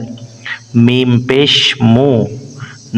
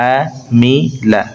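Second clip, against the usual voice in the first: slow drawn-out demo; much slower than usual. Shown as a demonstration. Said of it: "teacher models word slowly".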